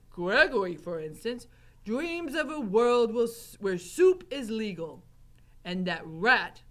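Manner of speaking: Gregory. A narrator's reading. Gregory to Mig about foolish dreams, emphatic character voice. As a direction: hold forth heavily, slowing at the end. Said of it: "said Gregory".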